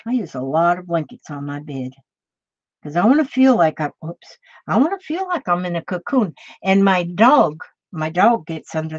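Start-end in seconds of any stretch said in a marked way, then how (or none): none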